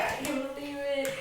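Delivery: soft, understated mock wail; hushed, lightly acted tearful voice; retold bawling. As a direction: imitate crying disbelief very quietly. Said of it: "I started bawling my eye".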